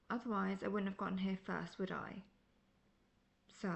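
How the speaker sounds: ordinary delivery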